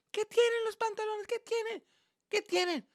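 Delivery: lightly voiced high-pitched mom; puzzled mother. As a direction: ask as a baffled mother. Acted mother asking about pants.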